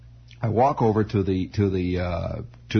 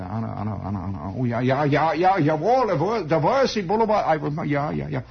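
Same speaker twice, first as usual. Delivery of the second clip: vague muttering building to higher, eager recall; drifting-off old man; 5 semitones higher than usual. Acted. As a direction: mumble the name, then perk up, German-accented. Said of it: "he's sort of drifting off".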